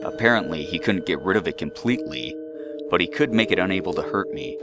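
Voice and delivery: ordinary delivery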